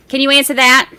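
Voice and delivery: normal talking voice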